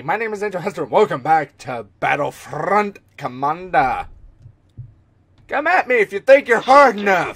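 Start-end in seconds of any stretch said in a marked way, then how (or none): 5.5-7.4 loud cocky challenge; taunting. Acted. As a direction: taunt them, loud and higher. Taunting the enemy.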